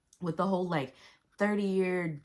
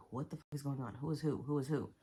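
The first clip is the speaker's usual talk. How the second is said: confused mutter; 2 semitones lower, 7 dB quieter than usual. Retold or imitated. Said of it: "I was just like".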